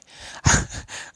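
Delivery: short breathy burst of laughter. Real, not acted. Storyteller distracted by a game, short laugh. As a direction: laugh at yourself mid-apology.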